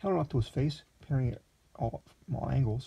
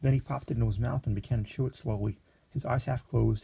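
ordinary delivery

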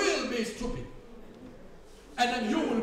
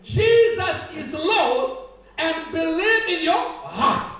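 loud, emphatic preacher with drawn-out words; preaching; 5 semitones higher, 7 dB louder, slower than usual. Real, not acted. Preacher quotes scripture to the congregation, emphatic preaching. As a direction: proclaim scripture slowly and forcefully.

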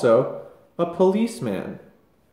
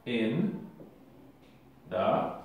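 slow dictation; 3 semitones lower, 6 dB quieter, slower than usual. Shown as a demonstration. Dictating while writing.

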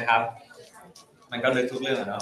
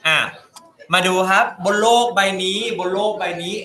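dramatic narration; 5 semitones higher, 4 dB louder than usual. Acted. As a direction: announce it like a nature documentary. Dramatic documentary-style intro. (บรรยายแบบสารคดีอลังการ)

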